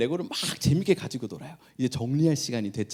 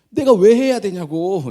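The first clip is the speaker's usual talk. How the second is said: louder, slow protest on 내가; copied indignant retort; 7 semitones higher, 10 dB louder, slower than usual. Retold or imitated. Younger child parroting the older one's protest, indignant. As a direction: throw 내가 back indignantly. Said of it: "금세 배워가지고".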